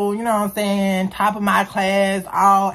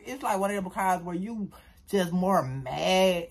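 normal talking voice

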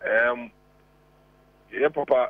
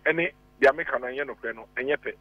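normal talking voice

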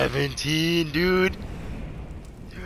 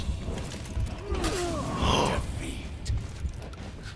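long high airy gasp; drawn-out shocked gasp; 13 semitones higher, 9 dB quieter than usual. Real, not acted. Long shocked gasp mid fight.

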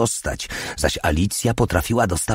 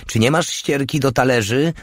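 mild, high-pitched shout; 8 semitones higher than usual. A narrator's reading. Alicja asks from the kitchen, mildly shouting. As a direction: yell the question from the next room, lightly. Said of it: "krzyknęła".

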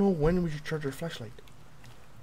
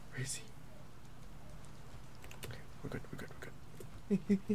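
soft whisper, calming yourself; whispered we're good; whispered reassurance; 3 semitones higher, 13 dB quieter, faster than usual. Real, not acted. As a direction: hush it to stay hidden. Hiding quietly from monster.

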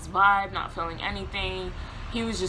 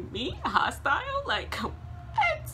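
incredulous disbelief; much slower than usual. Retold or imitated. Accused of being hostile.

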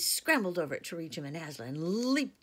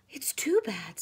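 distraught; 6 semitones higher, 8 dB louder than usual. Acted. Lucy grieving Aslan's body.

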